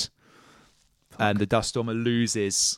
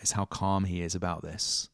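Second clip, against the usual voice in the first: ordinary talk, nothing marked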